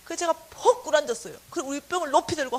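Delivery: ordinary talk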